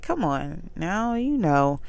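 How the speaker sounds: patient, hushed, deliberate; slow calm plea. Retold or imitated. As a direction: ease it out gently so he really hears. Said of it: "I try to slow my voice down".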